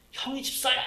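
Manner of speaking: shocked outburst. Retold or imitated. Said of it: "막 그러는 거야".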